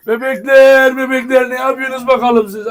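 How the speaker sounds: real excited greeting to the puppies; puppy talk. Real, not acted. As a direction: gush over the puppies.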